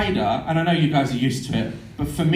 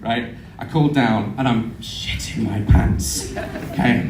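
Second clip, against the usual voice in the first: usual speaking voice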